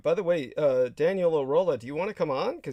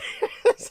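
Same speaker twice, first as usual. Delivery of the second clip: breathy evil cartoon cackle; villain cackle; 4 dB louder than usual. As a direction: cackle like Skeletor. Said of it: "So followed by a cackle".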